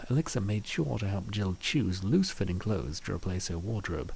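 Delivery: usual speaking voice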